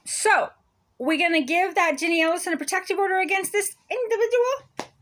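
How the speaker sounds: ordinary talk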